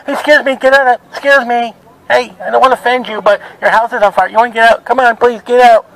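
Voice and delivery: hurried, hesitant mock politeness; timid polite warning. Preacher mocking someone too polite to warn of fire, meek and apologetic. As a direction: act out a meek, apologetic warning, rattled off quickly.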